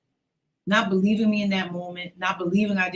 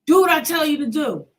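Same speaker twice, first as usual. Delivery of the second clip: higher, louder order; angry command; 8 semitones higher, 7 dB louder than usual. Retold or imitated. Uncle pushing his niece down, angry loud command. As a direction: snap the order at her.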